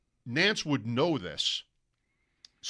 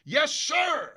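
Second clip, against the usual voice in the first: much higher, loud exultant "Yes, sir!"; excited broadcast call; 13 semitones higher, 5 dB louder than usual. Retold or imitated. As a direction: cry it out like a thrilled announcer. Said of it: "Like that".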